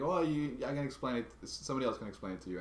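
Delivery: hasty clerk. Retold or imitated. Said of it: "She was like".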